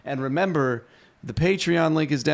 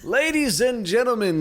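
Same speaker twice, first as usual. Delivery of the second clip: announcer voice; 10 semitones higher, 4 dB louder than usual. Acted. Host signs off the episode.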